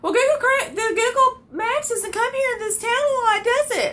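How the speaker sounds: high squeaky mock of the woman arguing. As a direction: squeak her argument back mockingly. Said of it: "she starts arguing with me".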